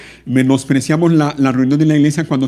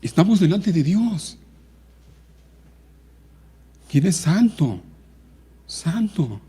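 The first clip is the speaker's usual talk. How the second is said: reverent awe; 6 semitones higher, 3 dB quieter, slower than usual. Real, not acted. Awed reminder of God's holiness.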